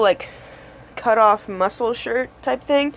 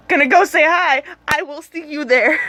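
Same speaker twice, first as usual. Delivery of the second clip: excited; 6 semitones higher than usual. Retold or imitated. Eager quote about meeting the band.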